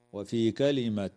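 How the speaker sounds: ordinary talk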